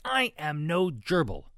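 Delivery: slow, emphatic, high and loud; snapping. A narrator's reading. Ruby denies being a gerbil, snapping. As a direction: spell it out indignantly, word by word. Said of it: "she snapped".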